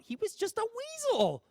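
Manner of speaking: high squeaky weasel impression. Interviewer mimicking the actor's weaselly character voice. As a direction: pitch way up like a sly little weasel.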